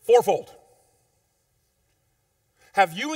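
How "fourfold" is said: loud, rising build to fourfold; call and response. Shown as a demonstration. Preacher leading the church in a loud response. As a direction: lead the congregation, louder on fourfold. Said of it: "Say with me".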